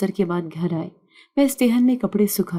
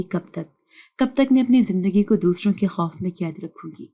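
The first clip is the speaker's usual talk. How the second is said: usual speaking voice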